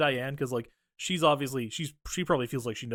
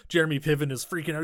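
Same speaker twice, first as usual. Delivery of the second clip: normal talking voice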